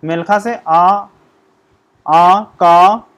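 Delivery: आ and का drawn out very slowly and loud; teacher modelling आ का; slow sound demo. Shown as a demonstration. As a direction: stretch each vowel so the class hears it. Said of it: "यहाँ पे उदाहरण भी दिया हुआ है".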